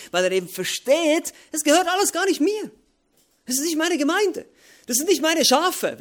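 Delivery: high, loud proclamation; preaching climax. Acted. Preacher telling the church it is not his flock, loud and high. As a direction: ring it out over the hall.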